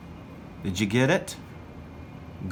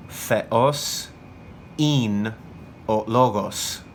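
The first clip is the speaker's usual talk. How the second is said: word by word, with pauses between; spaced-out phrase for students to repeat; deliberate dictation; much slower than usual. Shown as a demonstration. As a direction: dictate firmly, leaving gaps after "Theos" and "in".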